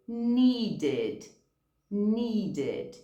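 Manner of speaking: "needed" ends in a full id syllable; teacher models "needed" as need-id. Shown as a demonstration. Teacher shows the id ending. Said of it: "que já termina em D".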